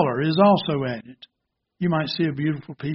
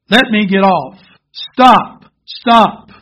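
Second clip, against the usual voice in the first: loud, high shouts, rising on each plea; screaming; 4 semitones higher, 9 dB louder, much slower than usual. Acted. Teacup spun on the potter's wheel, acted loud screams. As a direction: shout to be let off, higher and harder each time. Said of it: "I screamed".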